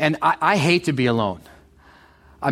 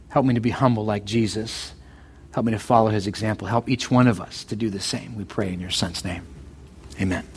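prayer; 7 semitones lower than usual. Acted. Pastor praying aloud.

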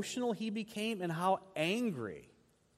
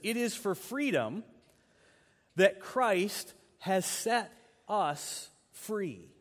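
slow scripture quote; 3 semitones higher, much slower than usual. Retold or imitated. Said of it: "He says".

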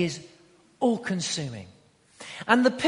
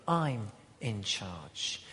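low, slow, quieter mock-emperor boast; pompous emperor; 10 semitones lower, 9 dB quieter, much slower than usual. Preacher voicing the Roman emperor, low and slow. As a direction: puff up like a proud emperor declaring power.

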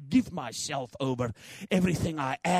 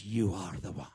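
whispering; 9 semitones lower, 6 dB quieter than usual. Acted. God whispering to chosen one.

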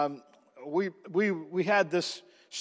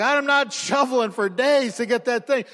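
excited relief; 6 semitones higher, 5 dB louder than usual. Retold or imitated. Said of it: "I'm like".

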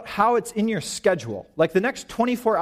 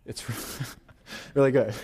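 laughing; 5 semitones lower, 4 dB quieter, faster than usual. Real, not acted. Laughs at own skipping.

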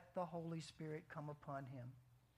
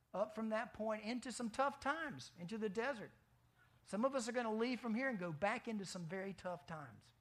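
ordinary talk, nothing marked

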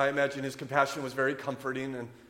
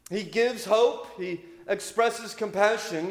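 higher and louder, a preacher's rousing sermon voice; preaching at full force; 7 semitones higher, 5 dB louder than usual. Acted. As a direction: proclaim it like a fired-up preacher from the pulpit.

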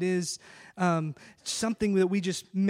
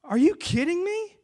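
incredulous; 9 semitones higher than usual. Real, not acted. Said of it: "preacher's incredulous outburst".

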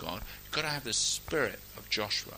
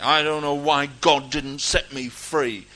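loud, slowed mock grievance; acted-out bitter questioning; bitter complaint; 3 semitones higher, 9 dB louder than usual. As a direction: gripe at God like a wronged believer. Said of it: "We get a bit bitter about it".

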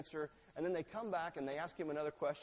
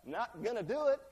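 higher, louder flat no; blunt cheeky refusal; 6 semitones higher, 8 dB louder than usual. Acted. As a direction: refuse with a shrug. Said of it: "hand it to the first son".

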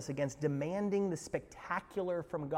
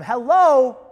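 much louder incredulous call-out; emphatic hello; 9 semitones higher, 16 dB louder than usual. Acted. Preacher stressing the parted sea, incredulous. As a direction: jolt the congregation awake.

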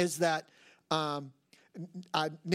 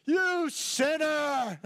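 slow, higher, drawn-out mock rebuke; Holy Spirit rebuke; 7 semitones higher, 3 dB louder, much slower than usual. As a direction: play God scolding you, slowly. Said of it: "the Holy Spirit speaks to me".

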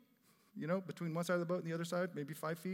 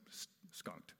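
whispered aside; 8 semitones lower, 13 dB quieter than usual. Acted. Pastor whispering the punchline about fishless nets, hushed. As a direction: drop to a hushed whisper on the word.

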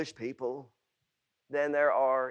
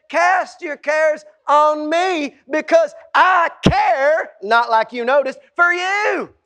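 loud, high, driving sermon; preaching at full force; 7 semitones higher, 11 dB louder than usual. Acted. Preacher pleading with the church, full-force preaching. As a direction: build the sermon to a passionate climax.

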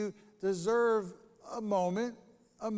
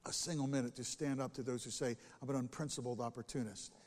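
quiet, measured reread; 6 semitones lower, 5 dB quieter, faster than usual. Shown as a demonstration. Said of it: "Don't think that I'll hesitate".

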